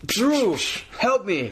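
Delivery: high-pitched mock plea; hammed-up cry for rescue; Del Rio impression. Host as Del Rio calling his friend, mock desperate. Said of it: "Do you wanna be A D R".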